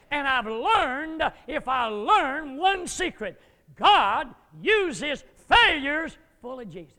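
slow, loud, emphatic preaching; booming sermon climax, slow and loud; full-force preaching. Acted. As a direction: hammer the secret home slowly. Preacher drives home the point.

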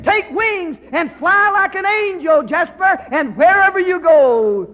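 high, loud, ringing preacher's cry, repeating the name; preaching at full force. Acted. Master sending Jasper out to tell everyone, a loud, high preaching cry. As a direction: shout the blessing like a revival climax.

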